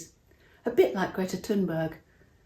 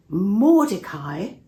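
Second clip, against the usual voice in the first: shocked question; 8 dB louder, much slower than usual. Acted. Haman hears his enemy's name.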